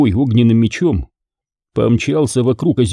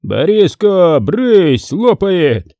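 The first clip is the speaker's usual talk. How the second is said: laughing bark; 7 semitones higher, 3 dB louder, much slower than usual. Acted. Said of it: "рявкнул со смехом Демьян".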